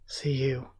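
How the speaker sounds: unvoiced, trailing whisper; hushed half-sentence. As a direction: breathe the words out, barely there.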